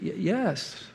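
tiny, soft, stammered yes; little voice. Retold or imitated. Inner voice admits to wife, tiny and sheepish. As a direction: shrink it to a small sheepish voice. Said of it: "this little voice saying".

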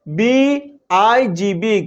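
letters stretched out, very slow; very slow spelling. Shown as a demonstration. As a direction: spell it out very slowly for the class. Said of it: "এবার দেখো example".

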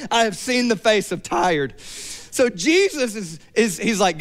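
ordinary talk

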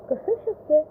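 as if it can't be; disbelief. A narrator's reading. Husband doubting his wife's news, incredulous.

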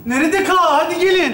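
high-pitched call across rooms. Acted. Host summoning his friends once wives hide, calling out. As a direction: call the others to come back in.

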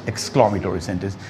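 ordinary delivery

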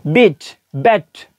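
बीट with long ee then बैट with open a, drawn out; teacher drawing out बीट and बैट; slow contrast demo. Shown as a demonstration. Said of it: "क्या pronunciation है".

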